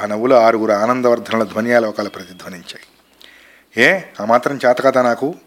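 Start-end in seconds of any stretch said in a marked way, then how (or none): none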